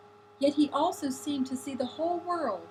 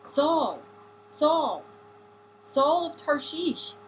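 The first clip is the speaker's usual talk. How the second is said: mildly commanding call, louder, the last words slow; 3 semitones higher, 5 dB louder, much slower than usual. A narrator's reading. The voice calls again to the fallen Saul, mildly commanding. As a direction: call the name as if not to be denied. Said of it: "The voice commanding, not to be denied".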